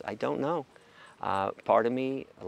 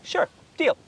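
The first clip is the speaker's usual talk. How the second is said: very high, loud mock agreement; smug agreeing abuser; 5 dB louder than usual. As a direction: agree smugly like a crafty abuser. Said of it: "I'd say".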